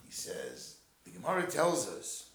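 ordinary delivery

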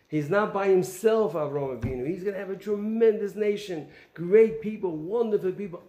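impassioned preacher voicing God; emphatic declaration. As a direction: proclaim it forcefully as God's own wish. Voicing God's emphatic reason.

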